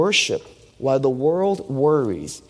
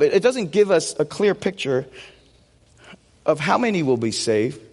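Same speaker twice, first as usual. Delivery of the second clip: normal talking voice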